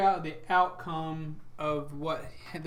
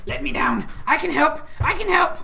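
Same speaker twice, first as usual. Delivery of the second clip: high, loud, pleading little helper; eager yelling; 11 semitones higher, 8 dB louder than usual. Acted. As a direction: shout excitedly to be let down and join in. Voices Burb yelling from Arlo's back.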